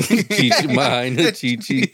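a hint of Chicano girl voice.

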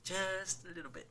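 soft afterthought repeat; quiet echo. Shown as a demonstration. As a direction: murmur it again under the breath. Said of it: "it slips just a little bit".

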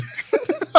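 breathless high-pitched giggle; high wheezy laugh. Real, not acted. Laughing at own habit.

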